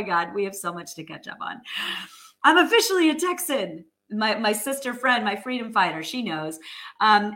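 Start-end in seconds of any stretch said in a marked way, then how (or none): 2.4-3.9 excited. Real, not acted. Gushing to friend in chat.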